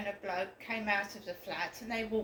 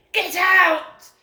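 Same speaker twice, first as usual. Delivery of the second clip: sudden high, very loud yell; imitating mum's furious shout; 7 semitones higher, 15 dB louder than usual. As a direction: bellow it like an order to leave. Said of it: "she shouted at me like that".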